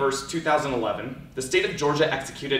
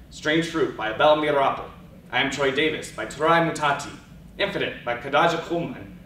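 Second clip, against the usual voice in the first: ordinary talk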